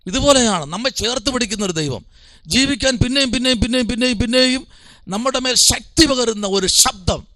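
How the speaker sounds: high, sustained, urgent preaching; impassioned preaching. Real, not acted. Preacher assuring the congregation of God's embrace, impassioned.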